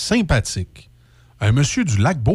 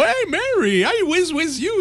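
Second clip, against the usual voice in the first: much higher, hammed-up English speaker, laughing; goofy put-on anglophone voice with a laugh; mock English accent; slower than usual. Host clowns at his own bad English, mock accent, high and laughing. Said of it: "je faisais le niaiseux tantôt".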